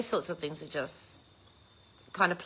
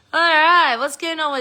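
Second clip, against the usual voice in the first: excited; 9 semitones higher, 10 dB louder than usual. Real, not acted. Greeting chat viewers loudly.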